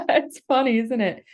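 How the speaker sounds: loud, high, laughing. Real, not acted. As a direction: laugh through it. Laughing at yawning listeners.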